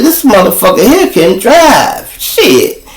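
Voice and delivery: high-pitched impressed officer; acted-out excited disbelief; retold amazement.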